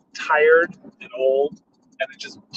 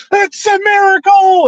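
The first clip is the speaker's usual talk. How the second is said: much higher, louder, slow shout of joy; joyful cry; 7 dB louder, slower than usual. Retold or imitated. Hecklers jump up cheering as the old dog stands, a much higher, louder, slow joyful shout. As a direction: proclaim it like a revival preacher. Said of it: "we both just jump up".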